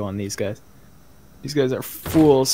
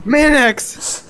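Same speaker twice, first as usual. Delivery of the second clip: high loud shout of the name; yelling; 12 semitones higher, 6 dB louder than usual. Real, not acted. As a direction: shout the name, higher and louder. Player yelling name in fight.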